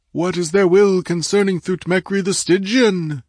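high, loud, declaimed prayer to a goddess; resonant priestly invocation. A narrator's reading. Priest beseeching the goddess on the dais to speak, resonant invocation. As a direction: cry out like a high priest invoking his deity. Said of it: "cried in the deep, rich resonance".

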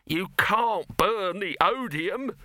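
high, slow, indignant objection; high-pitched outraged protest; snapped protest. A narrator's reading. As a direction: snap it out in alarm, stretching the words. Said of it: "snapped Dibbler".

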